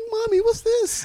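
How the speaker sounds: put-on high kid's voice asking; squeaky little boy; child voice. Voicing a young son.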